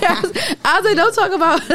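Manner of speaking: high-pitched, words breaking into giggles; cracking up while trying to get the line out; laughing hard. Real, not acted. Cracking up mid-sentence.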